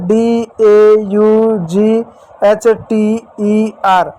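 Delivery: each letter spelled slowly and separately; spelling out letters slowly. Shown as a demonstration. Teacher slowly spells daughter for the children. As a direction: spell the word out slowly for the class. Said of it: "एक शब्द है".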